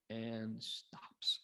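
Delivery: dramatic whisper. Acted. Teacher builds suspense for listeners, sudden whisper. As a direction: breathe it out as a hushed whisper.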